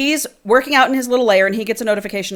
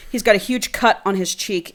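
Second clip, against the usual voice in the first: usual speaking voice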